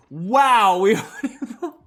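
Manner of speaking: real laughter, high and loud; laughing. Real, not acted. Player cracking up at the same roll coming up again. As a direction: laugh through the words in disbelief.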